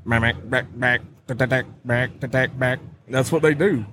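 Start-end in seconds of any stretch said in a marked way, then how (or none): 0.0-2.8 clipped mack tack quacks on the water; duck call honks. Shown as a demonstration. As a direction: imitate mallards feeding on the water. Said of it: "when they're on the water".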